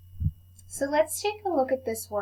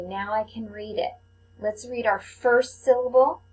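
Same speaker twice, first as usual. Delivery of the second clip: ordinary talk, nothing marked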